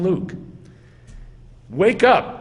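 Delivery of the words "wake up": emphatic command. Retold or imitated. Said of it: "It's an imperative, emphatic".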